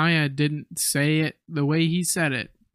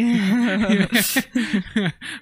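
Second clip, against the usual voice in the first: burst of laughter; 5 semitones higher than usual. Real, not acted. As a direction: break into laughter. Laughing at the line.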